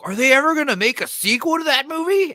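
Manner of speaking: high, loud eager question; eager friend. Retold or imitated. Friend asking about a sequel, high and eager. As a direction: ask it like a hopeful kid. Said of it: "one of my friends like".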